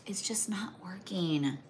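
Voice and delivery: slow, breathless whisper of defeat; frustrated whisper. Real, not acted. Crafter struggling with gingerbread pieces, whispered frustration. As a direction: let it slip out under your breath. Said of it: "no matter how hard I'm focused".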